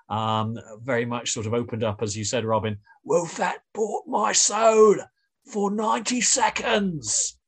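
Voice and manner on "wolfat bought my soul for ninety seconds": slow, high Cockney villain voice; Cockney accent. Retold or imitated. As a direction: drag out the line in a Cockney accent. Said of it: "in a kind of Cockney accent".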